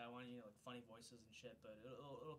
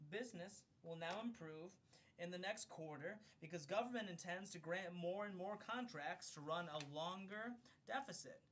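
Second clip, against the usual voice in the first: raised, clear read-aloud voice, higher and louder than his chat; formal book-reading voice; 5 semitones higher, 7 dB louder, slower than usual. Acted. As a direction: read it like an audiobook narrator. Reads a book foreword aloud.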